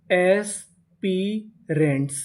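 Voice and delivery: Aspirants slowly split into its parts; syllable by syllable. Shown as a demonstration. Teacher sounds out a word for learners, aspirants slowly in pieces. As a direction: stretch each piece of aspirants with gaps between. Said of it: "aspirants का मतलब होता है".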